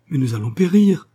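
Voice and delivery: high, loud panicked cry; frightened cry. Acted. The engineer in the sea crying out in fear. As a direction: cry out that death is near. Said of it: "s'écria Portsnaon".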